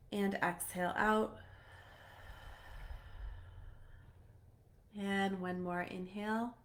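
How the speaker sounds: soft, calm meditation voice with long pauses; guided meditation. Real, not acted. Host guiding viewers through a heart activation, soft meditation voice. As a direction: gently guide them inward, leaving room to breathe.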